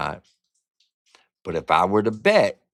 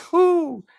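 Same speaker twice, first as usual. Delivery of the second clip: whew; 4 dB louder than usual. Real, not acted. After feeling a donation message.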